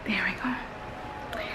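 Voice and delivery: hushed character whisper; whispering while hiding. Pretend. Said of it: "So I'm hiding in my bathroom".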